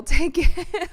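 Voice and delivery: amused grudging acceptance; laughing. Real, not acted. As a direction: laugh while conceding the point. Laughing off a missed travel.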